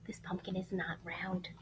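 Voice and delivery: put-on breathy secret whisper to the kids. Whispering a secret to the listening children. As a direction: let them in on a secret.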